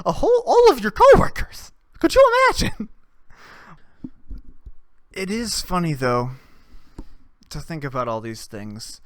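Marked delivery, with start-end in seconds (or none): 0.0-2.9 very high, loud incredulous cry; shocked disbelief. Real, not acted. Imagining the coworkers hearing the recommendation, high and shocked. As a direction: squeal it out in disbelief.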